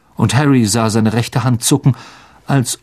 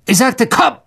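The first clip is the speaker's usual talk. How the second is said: high, angry insistence; ungeduldiger Befehl; 10 semitones higher than usual. A narrator's reading. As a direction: order a servant who won't obey, pitched up. Master insists, elf does not obey. (gereizt und hoch befohlen)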